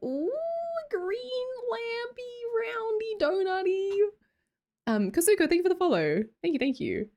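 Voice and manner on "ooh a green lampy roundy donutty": very high, soft, stretched squeal of longing; cutesy cooing. Acted. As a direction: coo over it in a tiny sing-song voice. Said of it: "and I'm like".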